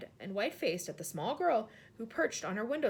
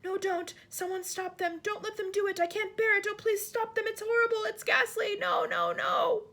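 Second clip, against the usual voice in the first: high, loud anguished sleep-talk; a sleeping queen begging in her dream, high and loud; crying out in sleep; 11 semitones higher, 6 dB louder than usual. Acted. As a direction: cry out from inside a nightmare. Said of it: "a slightly blurred sleep talker's voice".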